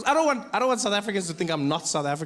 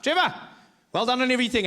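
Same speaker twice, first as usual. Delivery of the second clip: excited stranger; 7 semitones higher than usual. Retold or imitated. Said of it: "Strangest things people would say to me".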